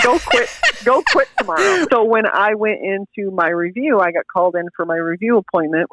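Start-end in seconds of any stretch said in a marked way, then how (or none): 0.0-1.9 high, laughing boss voice; mock high joking voice. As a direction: squeak it out as a joke. Boss's joking reply retold.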